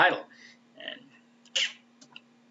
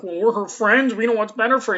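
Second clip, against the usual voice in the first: higher, louder mocking voice of the friends; defensive friends; 8 semitones higher, 8 dB louder than usual. The Scoobies defending themselves to Spike, higher mocking voice. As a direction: play the self-righteous friends. Friends' retort acted in story.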